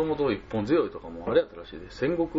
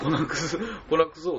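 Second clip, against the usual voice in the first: Kochi dialect exclamation; 6 semitones higher than usual. Retold or imitated. Teaching his friend a Kochi dialect word, playful Kochi cry. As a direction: toss out the Kochi curse with a grin. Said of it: "高知の方言ですよ".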